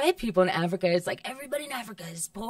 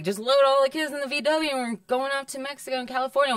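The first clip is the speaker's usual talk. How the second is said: parents' voice; 7 semitones higher than usual. Retold or imitated. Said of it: "and they're like".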